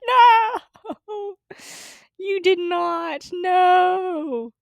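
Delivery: retold anguished no; high protest shout. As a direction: cry out in disbelief. Protesting at dad.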